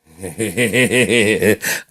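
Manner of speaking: loud, high rapid-fire laugh. Real, not acted. Host cracks up at the guy's rant, laughing. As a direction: burst into a rolling cackle.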